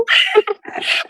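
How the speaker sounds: high laugh; amused laugh. Real, not acted. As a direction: laugh at the remembered remark. Laughs after quoting the supervisor.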